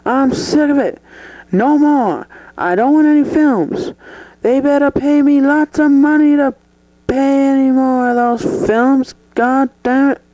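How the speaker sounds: fed-up star losing his temper on air; angry rant. Acted. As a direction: complain hotly to the interviewer. Actor ranting about his films.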